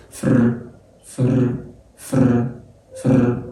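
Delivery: فر as one blended 'fr' syllable; sounding out letters. Shown as a demonstration. Teacher spelling out F and R together, blended فر. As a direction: drill فر, F running straight into R. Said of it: "حطهم على بعض كده، استهجوا".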